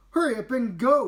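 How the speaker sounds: high, loud push to go; urgent. Acted. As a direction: urge her off while straining. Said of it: "replied Olivia".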